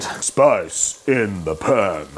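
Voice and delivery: slow, low, stretched call; drawn-out catchphrase. Acted. Cook to camera, slow catchphrase again. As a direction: drag every word out like a jingle.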